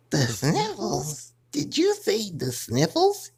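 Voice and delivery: "The sniffles?" slow and disbelieving; incredulous question. Acted. Incredulous echo.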